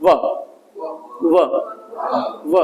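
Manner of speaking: short isolated व, a teeth-on-lip v; V sound. Shown as a demonstration. Teacher showing the sound of V, short teeth-on-lip व. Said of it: "आपके निचले वाले जो दांत है".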